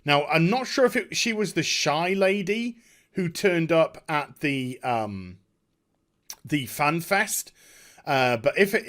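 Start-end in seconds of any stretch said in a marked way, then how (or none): none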